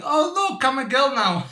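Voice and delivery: high, loud mock girly announcement; playful drag queen. Small child showing off as a girl, playful drag act. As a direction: put on a little kid playing drag queen. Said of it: "I was role-playing a drag queen".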